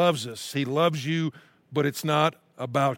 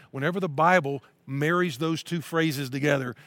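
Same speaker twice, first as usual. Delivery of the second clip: normal talking voice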